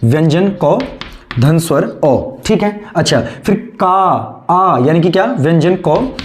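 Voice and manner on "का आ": का stretched, then a long आ alone; drawn-out vowel. Shown as a demonstration. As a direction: hold का until the आ stands out. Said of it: "स्वर क्या आपका आ".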